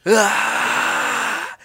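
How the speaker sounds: high strained grunt of effort; mock flexing grunt. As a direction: grunt like a guy hard flexing. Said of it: "he's like".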